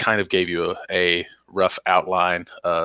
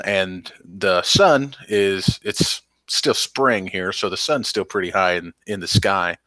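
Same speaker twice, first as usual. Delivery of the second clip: ordinary delivery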